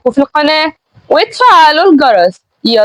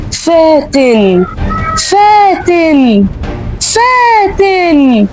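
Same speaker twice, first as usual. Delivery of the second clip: slow, drawn-out ghostly call; eerie calling voice; 3 semitones higher, much slower than usual. Acted. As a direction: call her name like a ghost, slowly. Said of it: "صوت خافت بينادي".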